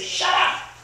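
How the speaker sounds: explosive re-enacted shout; retold angry outburst. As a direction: cut them off with a sudden loud command. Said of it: "I lost it".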